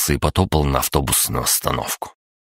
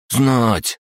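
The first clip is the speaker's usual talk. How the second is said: snappy clicking crab; 8 semitones higher than usual. Acted. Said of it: "понурился он".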